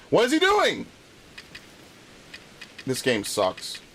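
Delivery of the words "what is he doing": much higher, squeaky disbelief; incredulous shriek. Real, not acted. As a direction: shriek it in real disbelief at the game. Frustrated at a game character.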